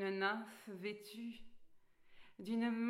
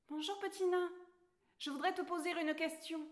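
put-on higher, bright girl character voice; Alice's voice; 9 semitones higher, faster than usual. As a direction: play the polite young girl Alice, pitched up. Alice greets the dwarf.

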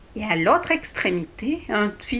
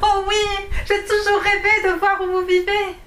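high, loud, bouncing with joy; excited exclamation; 10 semitones higher, 4 dB louder than usual. Acted. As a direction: squeal it while hopping on the spot. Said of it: "Alice sautilla sur place et s'exclama". (exclamation ravie de petite fille)

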